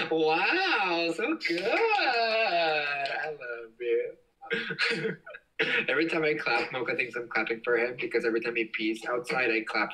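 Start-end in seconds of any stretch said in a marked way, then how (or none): 0.1-5.2 drawn-out, crying voice; teary. Real, not acted. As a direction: say it choked up with emotion. Moved by boyfriend's Spanish.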